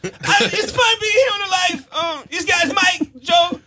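high strained yelping voice; Bobcat Goldthwait. Acted. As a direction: shout it, cracking and nervous. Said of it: "a quick Bobcat Goldthwait".

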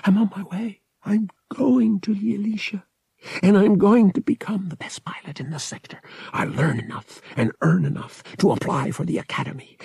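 telling his dead friend he made it, in a high whisper. A narrator's reading. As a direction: whisper it upward, fighting emotion. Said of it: "Han whispered".

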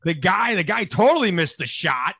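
high, loud furious rant; angry rant. Pretend. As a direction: vent at the reporter. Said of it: "I'd have been like".

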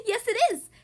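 high, loud, laughing reply; chuckle, then answer high and loud; high-voiced monster. A narrator's reading. Proud monster confirms the nail polish to the child, high and chuckling. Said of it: "he said in a high voice".

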